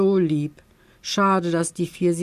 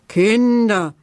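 loud, appalled cry; aghast; 5 semitones higher, 6 dB louder than usual. Acted. Tante Törtchen is appalled at the children's eating. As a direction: exclaim it in shock. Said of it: "sagt Tante Törtchen entsetzt".

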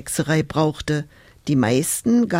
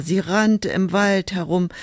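ordinary delivery, nothing marked

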